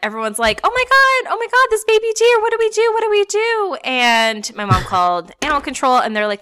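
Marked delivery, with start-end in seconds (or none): 0.6-3.8 high-pitched flustered neighbours; mock panic. Neighbours fretting over a baby deer, mock panic. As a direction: imitate a crowd fretting, voice way up.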